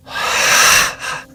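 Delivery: loud breathy exhale through the mouth; forceful exhale. Shown as a demonstration. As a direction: blow all the air out. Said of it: "Exhale through the mouth".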